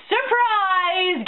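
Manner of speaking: high, drawn-out sing-song reveal; mock surprise. Podcaster revealing an obvious plot twist, mock surprise. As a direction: announce it like a fake big reveal.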